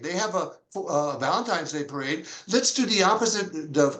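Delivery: ordinary delivery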